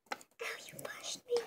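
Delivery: high, hushed complaint; hurt whisper. Pretend. Child pushed by sibling, hushed hurt complaint. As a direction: whimper it out.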